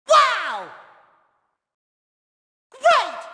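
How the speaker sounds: loud, very high cartoon burst; big wowed shout; amazed exclamation. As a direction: exclaim it high and let it drop. Tourist exclaims at the sights.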